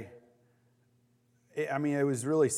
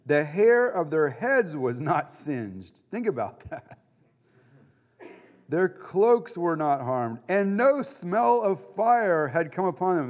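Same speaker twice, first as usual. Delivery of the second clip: slow, louder, deliberate preaching; preaching emphasis; 5 semitones higher, 5 dB louder, slower than usual. Acted. Pastor stressing the furnace miracle to his group, slow and loud. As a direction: lean on each phrase like a sermon point.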